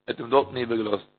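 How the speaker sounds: ordinary delivery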